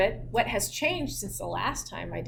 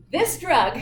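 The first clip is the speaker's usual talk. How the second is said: laughing; 5 semitones higher than usual. Real, not acted. Laughs at her own mistake.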